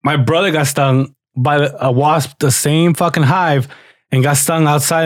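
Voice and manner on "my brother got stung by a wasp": ordinary delivery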